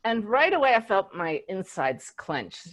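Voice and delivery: normal talking voice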